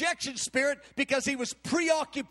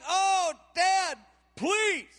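put-on whining teen plea; 5 semitones higher, much slower than usual. David begging his father to let him come, whiny. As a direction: beg your dad like a whiny kid.

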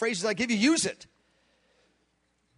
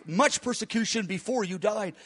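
ordinary talk, nothing marked

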